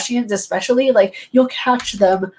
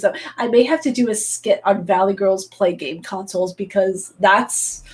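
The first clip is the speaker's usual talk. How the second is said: usual speaking voice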